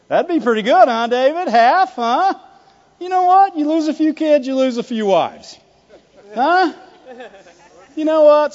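high, loud wheedling; exaggerated, high-pitched coaxing; mock cajoling. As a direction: act out someone pushing a lousy compromise. Mock voice offering David a compromise.

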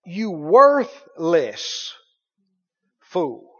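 preacher slowing right down to hammer "worthless"; emphasis on worthless. Shown as a demonstration. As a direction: lean hard on "worthless", stretch it slow. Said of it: "And that is the emphasis".

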